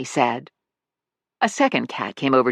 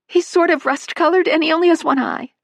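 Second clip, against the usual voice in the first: high, anxious motherly voice; a worried owner pleading, much higher; urgent pleading; 3 dB louder than usual. Acted. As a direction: press her to find Rusty. Missus Manley describes Rusty.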